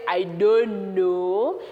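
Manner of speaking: low, slow, drawn-out denial; mock-innocent drawl; feigned innocence. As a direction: stretch it out like a fibbing child. Child lying to mommy.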